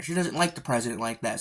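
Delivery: usual speaking voice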